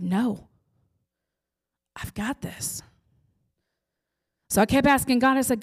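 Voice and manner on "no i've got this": unvoiced whispered reassurance from God. God answering the mother again, in a whisper. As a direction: breathe it like a secret promise. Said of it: "He said".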